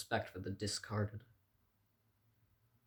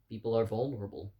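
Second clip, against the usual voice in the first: ordinary talk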